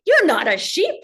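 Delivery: firm and coaxing, lightly voiced; lion insisting. Lion corrects the cub, lightly voiced and firm. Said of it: "And the lion's like".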